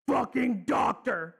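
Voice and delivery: villain exploding at the doctor's name; furious shout. Acted.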